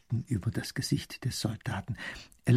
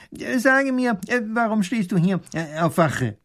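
high, halting official questioning; hemming minister's voice, pitched high; inquiring professor; 10 semitones higher, 4 dB louder than usual. Acted. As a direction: question the soldier with self-important hesitations. Professor formally questions the soldier.

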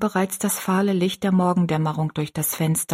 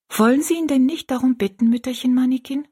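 put-on high, artless little girl; simple child's question; 6 semitones higher than usual. A narrator's reading. Rosalie urges the old woman to ask him, high and simple. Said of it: "fragte Rosalie einfach".

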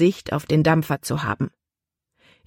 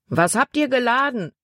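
higher-pitched call up to deck; man calling out; 7 semitones higher than usual. A narrator's reading. Man on shore asking about the cargo, calling out. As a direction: call the question out, pitched up but not truly shouted. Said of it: "rief ein Mann".

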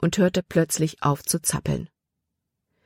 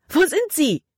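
frantic scream; 11 semitones higher than usual. A narrator's reading. Libby screams out as both go under. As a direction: scream it out in terror. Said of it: "schrie Libby auf".

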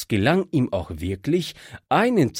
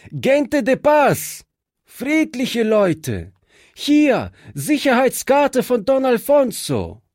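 urgent appeal; 11 semitones higher, 4 dB louder than usual. A narrator's reading. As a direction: insist you come in peace. Fritz pleads with the bandits. (Fritz beteuert den Banditen laut, hoch und langsam seinen Frieden)

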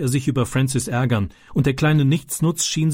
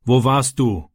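leicht gereizte Frage des Stiefvaters; gereizt; slower than usual. A narrator's reading. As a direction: knapp und verärgert nachfragen. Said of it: "fragte sein Vater gereizt". (der Stiefvater stellt Francis beim Wagenwaschen gereizt zur Rede)

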